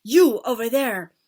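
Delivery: loud, slow accusing roar; furious accusation. Acted. Witch leader accusing the culprit, loud fury. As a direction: point her out in rage.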